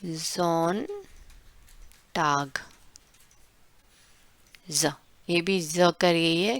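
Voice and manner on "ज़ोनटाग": ज़ोनटाग said softly and drawn out; slow pronunciation demo. Shown as a demonstration. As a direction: draw out ज़ोनटाग slowly, soft z. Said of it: "ज़ोनटाग यानी कि हमारा प्यारा Sunday". (ज़ोनटाग धीरे और नरम आवाज़ में)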